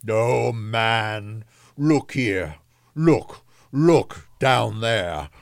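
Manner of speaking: drawn-out, commanding ghost voice; exclaiming ghost. A narrator's reading. The ghost shows Scrooge the children, exclaiming. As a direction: exclaim it slowly like a solemn spectre. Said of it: "exclaimed the ghost".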